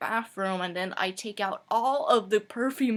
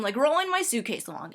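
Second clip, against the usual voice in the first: ordinary talk, nothing marked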